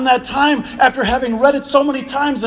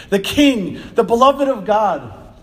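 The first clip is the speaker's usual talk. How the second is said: ordinary delivery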